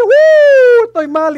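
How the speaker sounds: high, loud drawn-out whoop into happy cry; acted giddy outburst; elated whoop. Acts out being high on faith.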